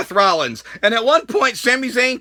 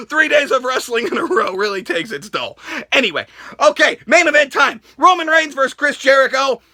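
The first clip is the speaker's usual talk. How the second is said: ordinary talk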